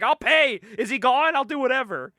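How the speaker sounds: high, loud mock-frightened pleading; panicked agreement. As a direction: give in like a scared boss. Frightened boss agrees to pay.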